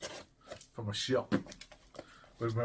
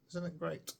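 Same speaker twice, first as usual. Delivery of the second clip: usual speaking voice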